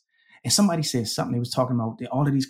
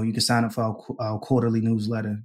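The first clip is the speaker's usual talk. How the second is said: ordinary talk, nothing marked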